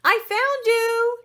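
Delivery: high, loud, slow triumphant call; Princess Tulip voice. A narrator's reading. Tulip opens the closet door, high triumphant call. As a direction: sing out the find, drawn out. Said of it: "said Princess Tulip".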